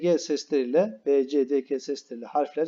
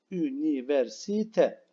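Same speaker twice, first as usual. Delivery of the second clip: Üniversite said slowly with every syllable; slow careful; much slower than usual. Shown as a demonstration. Teacher to pupils, slow unhurried Üniversite. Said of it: "Gayet rahat söyleyelim".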